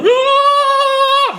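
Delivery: long, very high squeal of delight; sustained high-pitched fanboy "aah". Retold or imitated. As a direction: re-enact squealing like a thrilled fan. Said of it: "like, fanboy moment".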